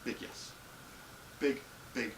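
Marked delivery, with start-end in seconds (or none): 0.0-0.5 much softer, understated big yes; quiet yes. Real, not acted. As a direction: say it low, almost to yourself. Answering thigh highs question.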